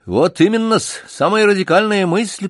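высоко и угодливо; лакей поддакивает сильным; подобострастно. Acted. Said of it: "поддержал подобострастно Бутон". (угодливо, высоким голосом)

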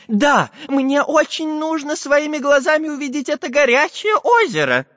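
high, eager professor voice; eager old professor. Acted. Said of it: "ответил профессор Пыхтелкин".

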